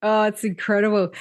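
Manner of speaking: ordinary talk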